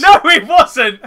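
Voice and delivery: high, loud, slow laughing denial; laughing protest. Real, not acted. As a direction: squeal the denial while laughing. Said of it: "That was more Cockney than yours".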